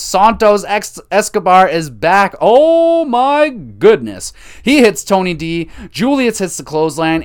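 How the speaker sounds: high, loud, thrilled outburst; excited shout. Real, not acted. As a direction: shout the surprise return, stretching oh my goodness. Escobar's surprise return.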